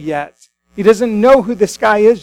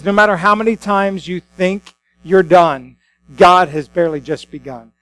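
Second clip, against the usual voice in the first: ordinary delivery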